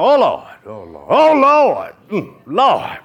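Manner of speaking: exaggerated loud wails to God; mock praying. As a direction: imitate an endless, overwrought pray-er. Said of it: "Some of us pray every, all day long".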